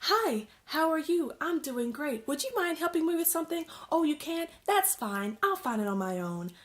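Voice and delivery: chirpy mock politeness, quick in the middle; overly nice person. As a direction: play the too-cheerful customer. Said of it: "those nice people that's just like".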